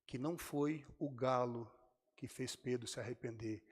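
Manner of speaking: ordinary talk, nothing marked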